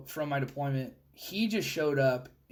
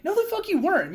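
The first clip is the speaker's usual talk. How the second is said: much higher, loud retort; calling out the lie; angry disbelief; 14 semitones higher, 4 dB louder than usual. Retold or imitated.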